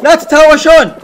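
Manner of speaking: very high, very loud panicked yell; rapid repeated no's rising into a drawn-out yell; frantic shouting. Real, not acted. Gamer begging the other players not to fight, frantic shouting.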